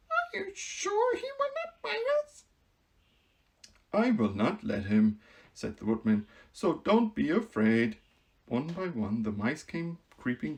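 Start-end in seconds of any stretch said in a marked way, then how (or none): 0.1-2.4 very high, small squeak of a mouse queen; timid voice. Acted. Mouse queen peeking from the grass, timid squeak. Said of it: "in a timid voice". 3.9-8.0 calm the frightened queen; reassuring. Acted. Woodman reassures.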